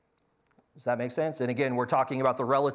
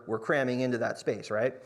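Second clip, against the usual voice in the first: ordinary talk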